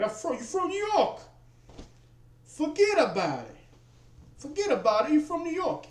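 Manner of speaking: high-pitched, hammed-up New Yorker; New York accent. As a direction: put on a loud caricature of New York speech. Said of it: "some linguistic accents and stuff".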